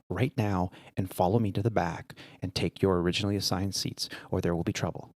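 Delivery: quietly firm, leaving no room for argument; stern retold warning. Purser confronts a passenger in the wrong seat, quietly stern.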